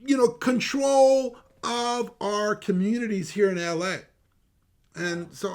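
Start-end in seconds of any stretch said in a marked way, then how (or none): 0.0-4.0 higher and louder, slowing on full throttle military; stunned disbelief. Retold or imitated. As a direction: let the shock spill out, then slow down. His stunned reaction, retold.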